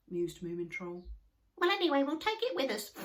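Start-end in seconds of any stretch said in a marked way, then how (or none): 1.6-2.9 high, loud, fast squeaky chatter; Sniff voice. A narrator's reading. Sniff deciding to carry the hat home, fast squeak. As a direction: rattle it off in a squeaky rush. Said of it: "said Sniff".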